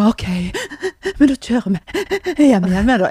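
loud, hammed-up tearful words between gasps; imitated sobbing. Mother re-enacts leaving her son, mock sobbing. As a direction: sob through it in exaggerated broken pieces. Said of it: "jeg griner og griner og griner".

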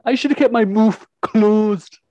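slow, high, loud Liverpool accent attempt. Shown as a demonstration. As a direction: try out a Scouse accent, drawn out. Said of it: "work on my Liverpool accent".

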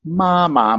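high level "ma", then falling "ma"; "ma, ma", sung then falling; first and fourth tones. Shown as a demonstration. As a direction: hold the first "ma" high, drop the second "ma". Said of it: "Mom yelled at the horse".

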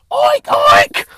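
loud, high squealed pig oinks. Retold or imitated. Host mimicking a roasted whole pig, squealed oinks.

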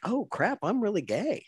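high, startled realization; sudden realization. Retold or imitated. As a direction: blurt it out like a sudden discovery. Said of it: "I'm like".

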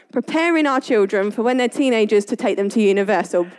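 ordinary delivery

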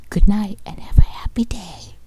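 menacing whisper close to the mic; ASMR whisper. Shown as a demonstration. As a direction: hiss threats into the mic. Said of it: "This is how you do A S M R".

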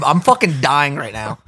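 ordinary talk

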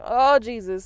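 loud, pleading mock wail; imitated distraught cry. Someone crying out in tearful prayer, imitated distress. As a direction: call on Jesus as if in tears. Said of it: "and the crying and the tears".

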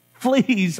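a read-aloud cry of alarm; startled cry. Acted. Narrator voices Corrie discovering fleas, a mild high cry. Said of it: "I cried".

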